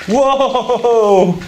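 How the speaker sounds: sudden high startled cry; jolted by a noise behind; startled whoa. Real, not acted. Sudden activity behind them.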